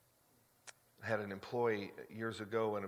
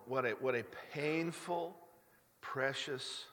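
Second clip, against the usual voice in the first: usual speaking voice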